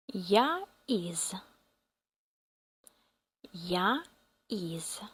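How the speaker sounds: ordinary talk, nothing marked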